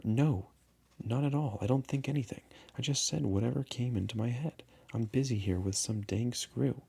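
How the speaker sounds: put-on brushing off a nagging child; irritated. Irritated pilot.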